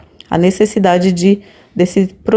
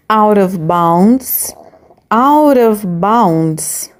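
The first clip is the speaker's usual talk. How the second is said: slow model pronunciation; much slower than usual. Shown as a demonstration. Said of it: "teacher models English phrase".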